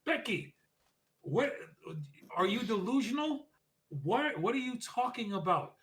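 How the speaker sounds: high mock-incredulous voice, well above his own; imitating Lyra sneering at Becky from the ring. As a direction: play her up as a disbelieving heel, pitched high. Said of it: "and she says, goes".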